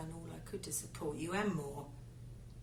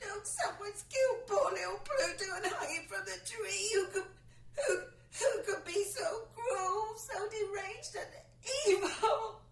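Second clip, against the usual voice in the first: horrified wail; 12 semitones higher than usual. Acted. Wife wails to her husband about who killed Pluto. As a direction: cry it out, half-laughing in disbelief.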